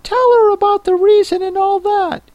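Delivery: very high creature voice; Mock Turtle. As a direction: squeak it out in a tiny, very high voice.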